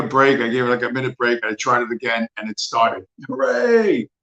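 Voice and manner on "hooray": excited cheer. Real, not acted. Van finally starts.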